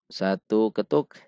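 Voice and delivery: ordinary delivery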